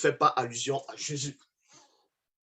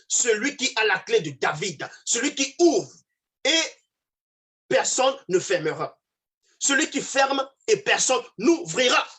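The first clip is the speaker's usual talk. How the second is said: high, ringing preacher's declamation; declaimed scripture reading; 8 semitones higher, 4 dB louder than usual. Acted. Preacher quoting Apocalypse on the key of David, declaimed. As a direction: proclaim each clause as scripture, pausing between them.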